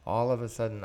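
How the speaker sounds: normal talking voice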